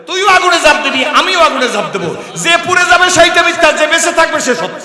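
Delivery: loud, high daring proclamation; shouted dare; fiery challenge. Retold or imitated. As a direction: throw down the challenge.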